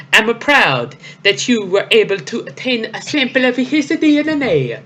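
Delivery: high, hammy villain voice. Acted. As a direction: ham up a proud villain boss. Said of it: "it's Giovanni".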